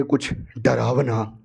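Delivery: slow, low "डरावना", falling at the end; ominous retelling. Retold or imitated. Character tells the others she saw something scary, ominous slow reveal. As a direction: draw out "डरावना" low and ominously, ending downward.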